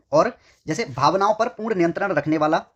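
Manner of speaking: ordinary delivery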